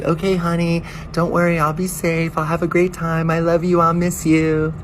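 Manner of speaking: high, loud syrupy sweetness; sugary high goodbye; sweet wife voice. Retold or imitated. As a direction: coo at the husband on FaceTime. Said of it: "she's like".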